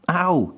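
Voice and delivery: high acted "ow" after a smack; mild pained yelp. A narrator's reading. Hitman smacked by Anne for his comment, mild pained yelp. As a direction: yelp it lightly, pitch jumping up.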